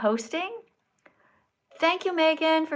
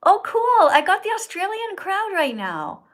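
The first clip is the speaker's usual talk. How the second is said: bright, high and loud delighted exclaim; thrilled to see so many Aussie viewers; excited; 9 semitones higher, 6 dB louder, slower than usual. Real, not acted. Host greeting the Australian viewers, much higher and louder with excitement.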